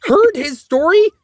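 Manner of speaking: squeaky exaggerated retelling of his own reply; high mock voice. Retold argument with director.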